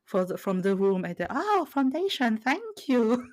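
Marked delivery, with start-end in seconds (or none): 1.3-3.3 high, bright-eyed mock gratitude, higher pitch; delighted thanks. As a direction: play it as overjoyed thanks to the crowd. Retelling a crowd's applause moment.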